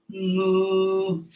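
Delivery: low soft cow moo. Pretend. Kids yoga cat cow sounds.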